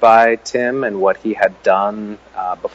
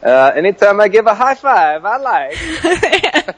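put-on much higher, louder comic Kazakh accent with a laugh; Borat accent; 11 semitones higher, 3 dB louder, slower than usual. Guest finally gives the host his comic Borat, much higher and louder. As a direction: ham up a cartoon Kazakh reporter, much higher. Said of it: "all I have is a Borat accent".

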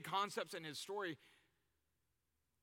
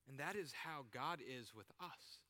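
normal talking voice

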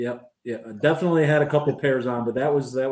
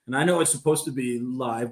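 usual speaking voice

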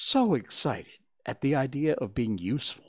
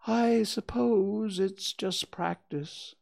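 thinking aloud; 8 semitones higher, slower than usual. A narrator's reading. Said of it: "he thought".

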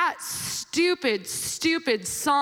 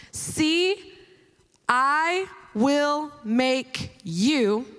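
solemn scripture reading; much slower than usual. Acted. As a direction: read each word slowly like a proclamation. Reads scripture aloud.

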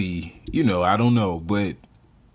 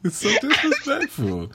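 laughing; 11 semitones higher than usual. Real, not acted. Cohost laughing at the story.

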